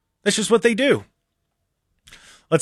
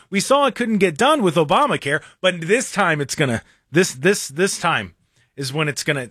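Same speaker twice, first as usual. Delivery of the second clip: ordinary delivery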